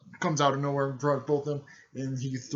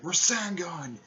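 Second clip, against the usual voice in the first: high mock battle call, falling away; called-out attack name; 6 semitones higher, slower than usual. Ninja calling out his Rasengan move, high mock call. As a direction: imitate a fighter naming his move.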